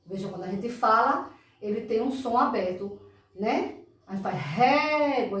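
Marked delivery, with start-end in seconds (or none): 4.4-5.4 "régua" with the é held long and open; drawn-out open vowel. Shown as a demonstration. Teacher shows how the sound travels, stretching "régua". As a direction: let the é of "régua" ring on before "gua". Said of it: "o som vai-se embora".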